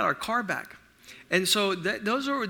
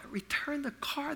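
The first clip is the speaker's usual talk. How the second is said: whispered disbelief; 6 semitones higher, 8 dB quieter than usual. Real, not acted. Incredulous whispered echo.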